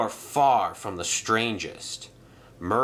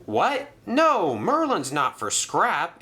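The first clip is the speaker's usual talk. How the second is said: much higher, louder protest; insisting; 9 semitones higher, 3 dB louder, slower than usual. Acted. Arthur protesting to Reg, much higher and louder. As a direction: stop the crane in alarm. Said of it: "insisted Arthur".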